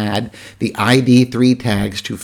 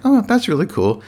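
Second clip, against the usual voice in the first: impressed; 10 semitones higher than usual. Retold or imitated. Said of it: "I was like".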